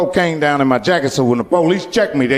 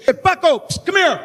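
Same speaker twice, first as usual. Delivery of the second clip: high shouted call across the lot; 11 semitones higher than usual. Retold or imitated. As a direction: yell for the kid, then hiss him over. Uncle calls nephew over.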